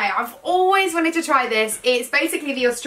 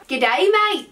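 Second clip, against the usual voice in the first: badly faked Australian accent; amateur mock-Australian accent; attempted Australian accent; 4 semitones higher than usual. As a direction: have a go at an Aussie greeting, not quite landing it. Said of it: "So something Australian".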